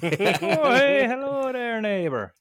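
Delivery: very high, drawn-out Canadian holler; slow, high-pitched cartoon Canadian greeting; Canadian accent. Shown as a demonstration. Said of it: "Canadian accent".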